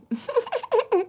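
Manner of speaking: high-pitched laugh; high giggling fit. Real, not acted. Laughs after reading a comment.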